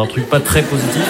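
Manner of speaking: usual speaking voice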